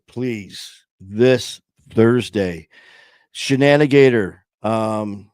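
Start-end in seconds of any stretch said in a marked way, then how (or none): 3.3-4.4 louder, higher shout-out to a viewer; excited loud shout-out; calling out a name. Real, not acted. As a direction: call a fan by name with enthusiasm.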